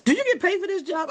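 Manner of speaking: squeaky-high incredulous question; mock disbelief. Imitating amazed airport staff questioning her.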